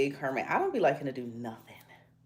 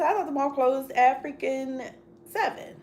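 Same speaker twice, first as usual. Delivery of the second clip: high, slow exasperated disbelief; imitating herself, pitched up; mock disbelief; 8 semitones higher, 5 dB louder than usual. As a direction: retell your own shocked reply. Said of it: "I said".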